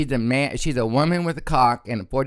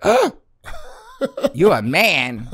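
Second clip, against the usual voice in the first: slow gasping mock shock, pitched up; 5 semitones higher, 4 dB louder, slower than usual. Pimp shocked to see the dick, slow gasping disbelief. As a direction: gasp then drag out you a man. Pimp's shocked reaction retold.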